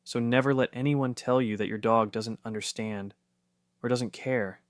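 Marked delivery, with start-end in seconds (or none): none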